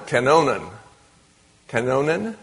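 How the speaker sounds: kanonen sounded out as ka-NO-nen; tentative Greek pronunciation. Shown as a demonstration. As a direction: try out kanonen carefully, unsure of the Greek. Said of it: "I think that's the way to pronounce it".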